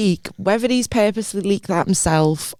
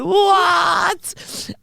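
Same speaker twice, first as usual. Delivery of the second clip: high, crying-out disbelief; shocked shout; 11 semitones higher than usual. Retold or imitated. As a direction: yell it in shock. Shocked reaction to the link.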